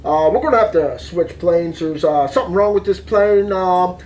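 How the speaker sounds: low-pitched pilot announcement with "uh" pauses; unhurried, low PA-style captain voice; airline captain. Retold or imitated. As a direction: imitate a captain on the cabin intercom, voice dropped. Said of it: "captain speaking".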